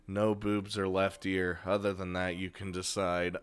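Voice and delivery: Rin, quietly setting a limit. Acted. Voicing Rin.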